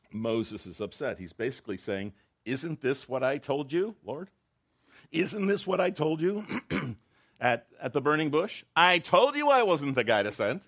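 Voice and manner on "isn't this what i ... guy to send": much higher, louder, exasperated whine; overdramatized complaint. Retold or imitated. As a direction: ham up an upset prophet complaining to God. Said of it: "I'm over dramatizing it".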